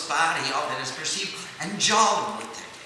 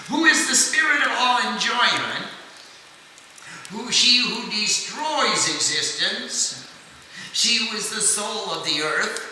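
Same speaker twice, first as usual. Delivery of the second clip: higher, louder chanting of sacred names; scripture recitation; 5 semitones higher, 4 dB louder than usual. Acted. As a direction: intone each name like a litany.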